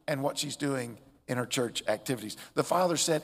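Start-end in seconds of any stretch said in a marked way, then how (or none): none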